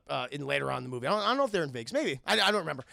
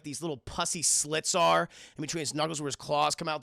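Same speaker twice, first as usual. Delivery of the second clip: ordinary delivery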